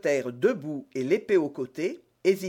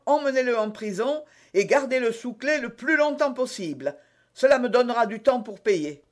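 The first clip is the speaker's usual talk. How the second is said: high, raised voice of outrage; indignant scolding; 7 semitones higher, 5 dB louder than usual. Acted. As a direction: berate him loudly for all to hear. D'Artagnan loudly feigns outrage at mercier. (indignation sonore et théâtrale)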